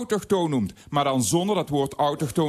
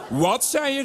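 roepen; 9 semitones higher, slower than usual. Retold or imitated. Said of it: "riep de buurjongen terug".

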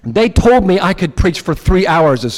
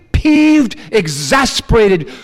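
ordinary talk, nothing marked

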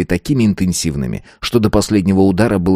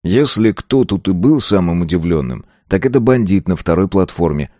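usual speaking voice

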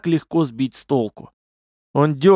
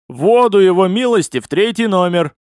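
loud, higher-pitched call; mighty yell; 6 semitones higher, 5 dB louder than usual. A narrator's reading. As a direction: holler the order down the corridor. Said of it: "раздался его могучий вопль". (могучий вопль)

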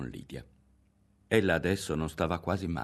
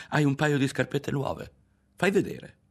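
eager; 8 semitones higher, 3 dB louder than usual. A narrator's reading. Boy eagerly asks to see shoes.

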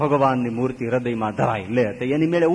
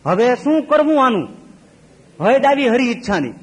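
exasperated; 6 semitones higher, 4 dB louder than usual. Acted. Preacher exasperated at babaji.

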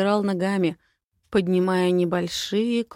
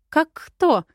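playful squeal; 8 semitones higher, 5 dB louder than usual. Acted. Flirty playful question.

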